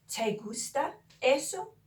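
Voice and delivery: slow "Te gusta eso" climbing on "eso"; drawn-out, emphatic rise to the end of "eso"; emphatic question tone. Shown as a demonstration. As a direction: lift "eso" high as a question. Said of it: "say it with that emphatic tone".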